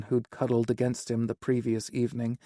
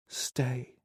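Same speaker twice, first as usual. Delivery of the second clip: soft breathy whisper; 6 dB quieter than usual. A narrator's reading. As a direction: barely breathe the word. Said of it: "he whispered".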